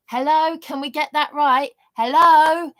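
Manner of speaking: loud, high mock call of hello. Real, not acted. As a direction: shout hello as if nobody is listening. Calling out to unresponsive authorities.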